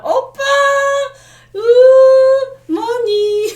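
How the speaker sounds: squeaky high cutesy whine for money; e-girl aegyo voice. Retold or imitated. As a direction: coo it like a begging e-girl. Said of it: "they do a lot of".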